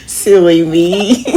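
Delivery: slow, laughing self-tease. Real, not acted. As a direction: chuckle it out slowly. Laughing at herself.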